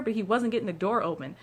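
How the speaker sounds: ordinary delivery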